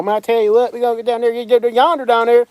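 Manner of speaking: high, slurred Alabama country twang; Alabama accent. Acted. Local Alabama man baffling an Englishman, high Alabama twang. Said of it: "somebody from Al-, Alabama".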